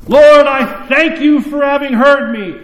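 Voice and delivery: high and loud, like praying for an audience; loud showy prayer. Retold or imitated. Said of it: "this odd prayer".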